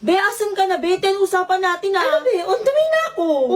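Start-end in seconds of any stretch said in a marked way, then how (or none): 2.4-3.6 high voice on a call from the bathroom; pitched-up excuse over the phone; echoing bathroom voice. Acted. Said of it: "ba't naging ikaw boses mo?".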